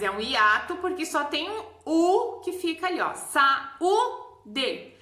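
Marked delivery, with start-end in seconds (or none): 3.1-4.9 "saúde" em três partes, SA-Ú-DE; U isolado. Shown as a demonstration. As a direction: pause entre as sílabas de "saúde", destacando o Ú. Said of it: "Ela fica isolada". (hiato bem marcado)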